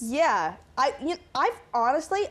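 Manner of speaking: ordinary delivery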